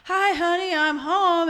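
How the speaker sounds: high, singing homecoming greeting; fifties sitcom husband. Acted. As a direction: call out cheerily as the hubby walks in. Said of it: "You know, he comes home".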